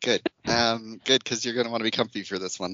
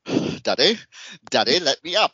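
dramatic dog; 5 semitones higher than usual. Retold or imitated. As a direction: beg Daddy like an overdramatic dog. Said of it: "like, all dramatically".

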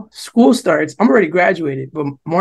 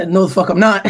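laughing refusal. Retold or imitated. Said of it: "So just like that".

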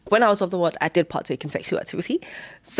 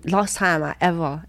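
usual speaking voice

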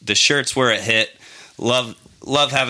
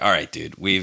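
low, softer mock brush-off; mildly dismissive; 5 semitones lower, 3 dB quieter than usual. Retelling brushing off a stranger at pool, mildly dismissive. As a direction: imitate brushing someone off, without much energy. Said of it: "kinda just brushed it aside".

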